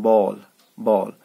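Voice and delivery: बॉल with a rounded half O vowel; half O sound. Shown as a demonstration. Teacher modelling बॉल for the class, slow and rounded. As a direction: draw out बॉल slowly with an O sound. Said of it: "half ओ जैसे बोलते हैं".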